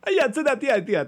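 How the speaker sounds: bad, high Jamaican accent; hammed-up Jamaican accent. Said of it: "I'm gonna do a Jamaican accent".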